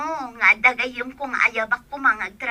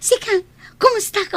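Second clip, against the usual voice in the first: laughing; 9 semitones higher, 5 dB louder than usual. Acted. Laughing during reunion.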